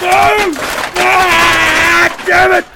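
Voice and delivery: angry yell; angry cursing. Real, not acted. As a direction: curse out loud in frustration. Host cursing another clip.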